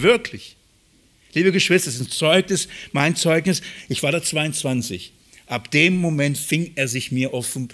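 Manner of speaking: usual speaking voice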